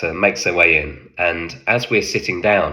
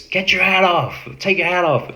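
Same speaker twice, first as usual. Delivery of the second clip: higher mocking imitation of the officer; mock officer shout; 12 semitones higher than usual. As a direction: extras jeering in the officer's voice. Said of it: "It's all the extras shouting".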